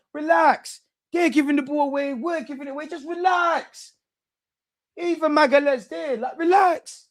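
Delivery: exasperated pleading. Real, not acted. Fan pleading again with team.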